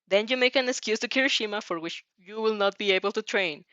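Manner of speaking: ordinary delivery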